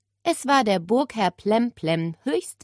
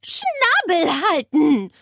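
put-on slow, higher, louder stern old-lady order; stern old aunt; 11 semitones higher, 5 dB louder, slower than usual. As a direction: lay down the law, slowly. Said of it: "tönte es von der Zimmertür".

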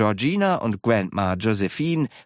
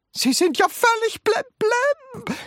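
put-on very high old-lady screech. A narrator's reading. Grandma shaking Wonka, screeching at him. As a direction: shriek it in outrage. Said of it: "kreischte Grandma Josephine".

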